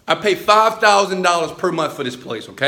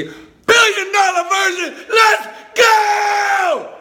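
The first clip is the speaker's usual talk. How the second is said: hype shout; 14 semitones higher, slower than usual. Acted. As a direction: blast the intro like a hype man. Hype intro shout to viewers.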